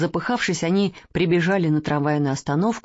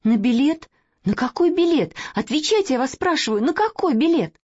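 insistent demand; 8 semitones higher than usual. Acted. Girl demands answers.

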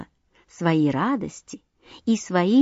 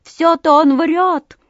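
higher, louder, slow and drawn out; indignant call; 8 semitones higher, 6 dB louder, slower than usual. A narrator's reading. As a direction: call it up out of the water. Ruff rebuts sparrow.

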